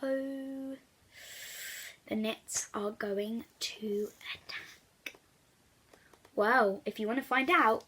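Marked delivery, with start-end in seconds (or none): none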